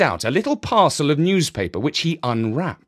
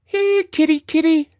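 put-on high, coaxing little girl, a bit louder; girl calling a cat; 5 dB louder than usual. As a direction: call the kitty out of the shadows. Jemima calls the cat.

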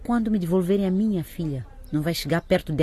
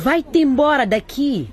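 angry order; 7 semitones higher, 4 dB louder, slower than usual. Acted. Wife throwing husband out.

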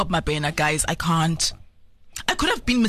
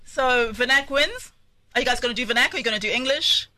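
ordinary talk, nothing marked

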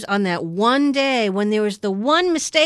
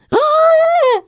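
gasp; 8 dB louder than usual. Retold or imitated. Mock shock at reveal.

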